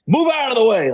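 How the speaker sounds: high, urgent mock shout; retold angry yell. Old man trying to get at the hawk, mock angry yell. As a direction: imitate a furious man ordering someone aside.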